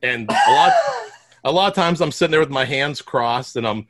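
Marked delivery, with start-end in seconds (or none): 0.3-0.7 shout. Real, not acted. Sudden shout mid-story.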